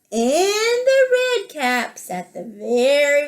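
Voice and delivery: animated read-aloud with drawn-out key words; lilting picture-book narration; children's story reading. A narrator's reading. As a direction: tell it to small children, lingering on the details.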